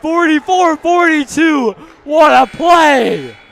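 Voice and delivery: very high, loud, stretched-out yell; play-by-play caller going wild on the and-one; ecstatic screaming. Real, not acted. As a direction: lose it completely on the big play. And-one play, commentator erupts.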